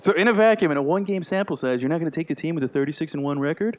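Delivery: put-on hammy New York tough-guy accent; Brooklyn accent. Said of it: "I don't know what that voice is".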